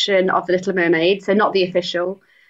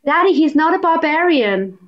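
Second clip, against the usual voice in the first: put-on higher-pitched Disney princess pleading, Californian accent; Californian audition voice; 8 semitones higher than usual. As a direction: act it out as a Californian Disney heroine. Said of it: "very Californian".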